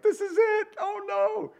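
high, panicked yelp; terrified. Acted. Disciples spotting a ghost on the water, high panicked squeal. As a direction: squeal it like you've seen a ghost.

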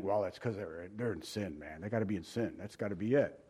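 low, very soft aside to oneself; mock judgmental thought. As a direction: think aloud in an undertone of suspicion. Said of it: "you automatically think".

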